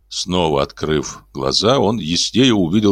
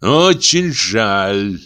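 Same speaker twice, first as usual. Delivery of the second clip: cold; 2 semitones higher, much slower than usual. A narrator's reading. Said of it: "сказал Саймон".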